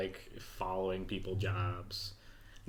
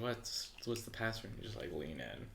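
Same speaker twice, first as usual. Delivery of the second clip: whispering a secret password; 10 dB quieter than usual. Acted.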